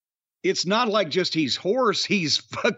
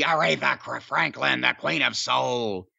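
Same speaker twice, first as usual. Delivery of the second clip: lower, put-on ring announcement; announcer impression; 5 semitones lower than usual. Joking about a WrestleMania announcement, low put-on voice. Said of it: "they used to joke".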